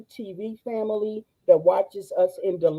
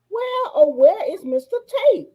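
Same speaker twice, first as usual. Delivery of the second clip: much higher, sing-song calling; playful sing-song; 9 semitones higher than usual. Real, not acted. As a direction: call out playfully.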